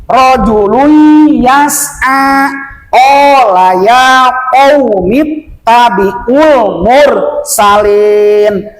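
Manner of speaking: slow chant-like reading with long level vowels; measured Quran recitation. Shown as a demonstration. Teacher recites the verse onward, slow drawn-out recitation. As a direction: recite slowly, holding the long syllables.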